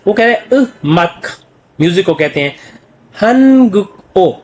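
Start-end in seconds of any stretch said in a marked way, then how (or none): 3.2-4.3 हनगुओ stretched very slowly, syllables apart; split reading. Shown as a demonstration. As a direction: drag हनगुओ out piece by piece. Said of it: "तो क्या बोलेगा?".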